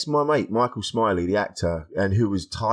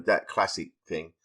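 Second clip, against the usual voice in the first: normal talking voice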